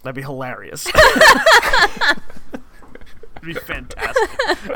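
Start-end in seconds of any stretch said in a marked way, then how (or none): 0.9-3.4 big high-pitched laugh burst; loud high laugh. Real, not acted. Laughs at not getting along. 3.4-4.8 amused. Real, not acted. Still laughing, amused.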